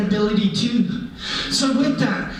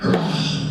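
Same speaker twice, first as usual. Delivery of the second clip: bear roar. Acted. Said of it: "then he went".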